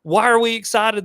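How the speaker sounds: high, loud acted-out question; puzzled excited shout. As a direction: yell it, caught up but clueless. His puzzled shout, retold.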